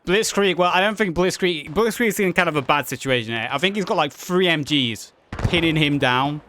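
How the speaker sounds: energetic match commentary; live play-by-play. Real, not acted. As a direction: call the action as it happens.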